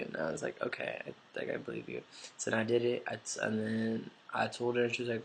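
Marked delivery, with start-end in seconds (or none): 0.6-2.0 low, soft, creaky reply; acting out a subdued okay; hesitant agreement. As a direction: give in quietly, voice dropped low. Retold reluctant agreement to friend.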